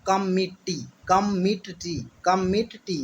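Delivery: "कम मीट टी" as three separate beats; memory chant of "कम मीट टी"; word split up. Shown as a demonstration. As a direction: drill "कम", "मीट", "टी" piece by piece. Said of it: "आपको इससे याद रहेगा".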